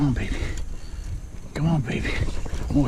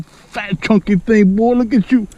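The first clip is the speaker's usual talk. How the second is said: delighted gushing; 8 semitones higher, 4 dB louder, slower than usual. Real, not acted. Admiring the caught fish.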